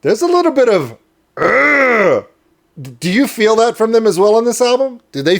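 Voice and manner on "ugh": short rough "ugh" growl; playful guttural grunt; mock growl. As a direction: growl it out like getting amped up. Mimes the band's amped-up grit.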